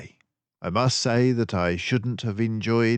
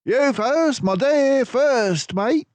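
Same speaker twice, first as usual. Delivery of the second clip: louder, higher, grandly polite; a courtly flourish, half joking; playful Basil; 6 dB louder, slower than usual. A narrator's reading. Basil bowing Dawson into the shop, grand and playful.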